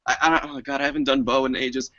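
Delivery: ordinary talk, nothing marked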